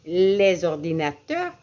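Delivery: "Les ordinateurs" linked with a Z sound; liaison. Shown as a demonstration. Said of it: "J'ai fait la liaison".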